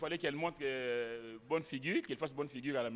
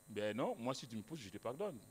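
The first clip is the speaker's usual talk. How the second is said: lower, much softer calm reply; gentle answer; 7 semitones lower, 8 dB quieter than usual. Retold or imitated. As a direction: answer softly, with quiet forgiveness. Speaker answers colleague gently. (réponse douce, plus grave et plus basse)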